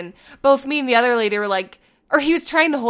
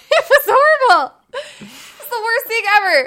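high, loud laugh-cry through the words; laughing helplessly; 11 semitones higher, 6 dB louder than usual. Real, not acted. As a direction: laugh so hard it nearly turns to tears. Bursts out laughing at herself.

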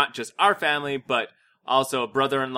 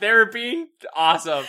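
high-pitched, laughing mock-tearful protest; mock choked up; 8 semitones higher, 4 dB louder than usual. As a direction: gush as if about to cry. Hammed-up tearful defence of film.

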